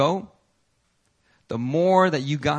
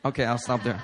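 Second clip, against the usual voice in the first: normal talking voice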